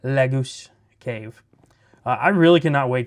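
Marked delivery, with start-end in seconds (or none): 0.0-1.4 slow, mock French accent on Lagoose Cave; playful hammed-up French accent. Shown as a demonstration. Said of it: "a sort of French accent".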